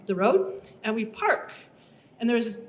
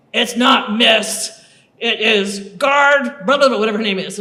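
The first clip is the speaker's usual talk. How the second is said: stern guard correction; 7 dB louder than usual. Retold or imitated. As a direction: spell out each word to correct her. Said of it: "bruiser of a woman".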